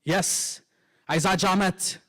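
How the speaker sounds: ordinary talk, nothing marked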